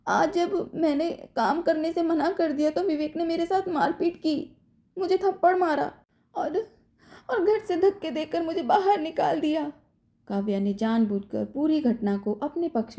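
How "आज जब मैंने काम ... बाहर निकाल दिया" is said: crying. A narrator's reading. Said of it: "Kavya ने रोते हुए आगे कहा".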